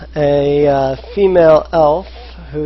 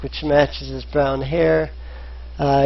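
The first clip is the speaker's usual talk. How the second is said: ordinary talk, nothing marked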